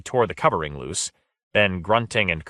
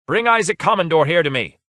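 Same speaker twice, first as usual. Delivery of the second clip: higher, louder curt order; loud command; 9 semitones higher, 5 dB louder than usual. A narrator's reading. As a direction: order the servant sharply. Lord commanding a servant.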